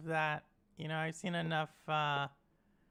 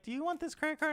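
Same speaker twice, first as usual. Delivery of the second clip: high-pitched, lightly imitated sales clerk; imitated store clerk; 8 semitones higher, faster than usual. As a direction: pitch it higher, like a pushy offer.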